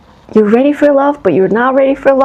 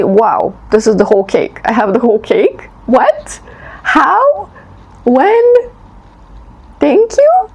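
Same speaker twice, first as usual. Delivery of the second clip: amazed disbelief. Retold or imitated. Reader voicing the listener who finally sees the whole cake, amazed. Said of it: "And then suddenly".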